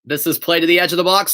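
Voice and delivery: live match commentary voice; steady broadcast commentary; play-by-play. Acted. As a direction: call the game as it happens.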